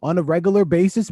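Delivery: ordinary talk, nothing marked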